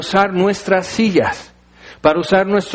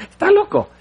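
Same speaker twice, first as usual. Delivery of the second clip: much higher, amazed "he's crazy"; incredulous driver. Acted. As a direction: exclaim in disbelief, pitched up. Taxista reacciona al elefante. (incrédulo, mucho más agudo)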